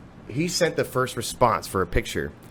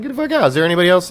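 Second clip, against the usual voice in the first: much higher and louder, a mock disbelieving cry; mock disbelief; 5 semitones higher, 6 dB louder, much faster than usual. Woman disbelieving that he runs the festival, mock shocked.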